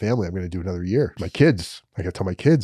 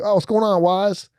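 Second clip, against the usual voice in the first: lightly voiced friend, pitched higher; a hint of a cheerful cellmate, higher voice; bunkmate Josh voice; 10 semitones higher than usual. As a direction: toss off a higher, easygoing bunkie question.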